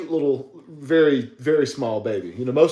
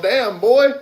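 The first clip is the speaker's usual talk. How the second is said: high, louder mock exclamation; mock neighbor exclaiming; 9 semitones higher, 6 dB louder than usual. Neighbor Rusty reacting to seeing Chris, high loud mock exclaim. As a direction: blurt it out high like an impressed neighbor. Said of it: "He'd be all".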